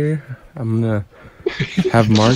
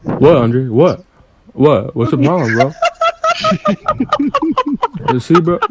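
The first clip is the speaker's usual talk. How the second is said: mock angry Mark; 11 semitones higher than usual. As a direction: play Mark getting worked up. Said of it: "do getting mad, Mark".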